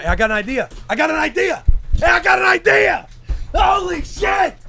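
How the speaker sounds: building from eager to high, loud shouting; mounting excitement. Real, not acted. Repeats idea with mounting excitement.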